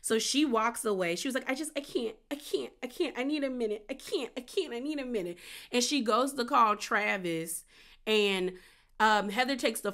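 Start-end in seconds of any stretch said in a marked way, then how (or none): 1.5-5.4 overwhelmed. Retold or imitated. Gina overwhelmed, walks away.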